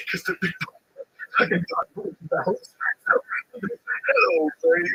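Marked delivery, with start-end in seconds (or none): 3.5-4.9 much higher, hammed-up coo of a friend calling his wife; higher mock sweet greeting. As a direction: pitch the cooing way up, hammed-up and silly. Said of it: "And he goes".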